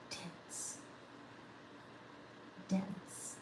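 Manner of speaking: barely audible whisper, to herself. Acted. Musing over a chat word, whispered. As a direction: whisper dense under the breath.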